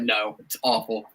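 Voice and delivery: put-on slow, soft grumpy old-man voice; curmudgeon voice. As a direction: grumble it like a cranky old man. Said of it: "I just thought you were being a curmudgeon".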